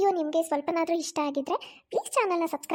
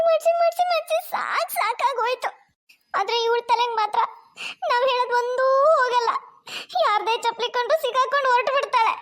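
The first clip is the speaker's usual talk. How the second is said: lamenting, rising in exasperation; a fed-up mother-in-law wailing mildly; exasperated mother-in-law; 5 semitones higher, 3 dB louder than usual. Acted. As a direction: complain to the heavens about her.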